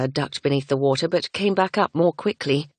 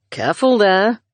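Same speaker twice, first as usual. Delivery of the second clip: deep masculine call; 5 semitones higher, 5 dB louder than usual. A narrator's reading. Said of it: "The deep, masculine voice".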